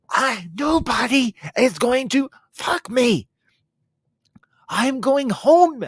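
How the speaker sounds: slow, high, loud declaration; over-the-top exit line, pitched high; dramatic acted outburst. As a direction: drag the words out in hammed-up indignation. Acts out Bethany's dramatic decision to leave.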